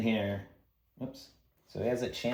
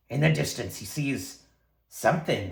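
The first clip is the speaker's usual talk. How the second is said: ordinary talk, nothing marked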